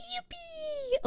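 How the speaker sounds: very high joyful squeal; squeaky yippee. Real, not acted. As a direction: squeal with delight. Celebrating not crashing.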